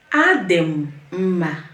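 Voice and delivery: "Adị m mma" slow, pausing before "mma"; slow broken-up model. Shown as a demonstration. Teacher models the reply for beginners, "Adị m mma" slow and split. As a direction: stretch "Adị m", pause, then "mma". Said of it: "One more time".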